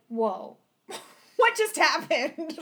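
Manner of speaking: half-laughing mock shock at a twist; startled whoa. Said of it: "And I was like".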